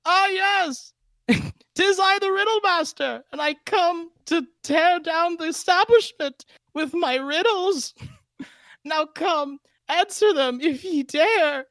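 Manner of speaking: very high, slow, grand theatrical riddler; Riddle Master voice. Pretend. DM voicing his riddle villain to players, very high and hammy. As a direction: boom it out like a pompous riddle-spouting wizard. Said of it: "what is the Riddle Master's voice?".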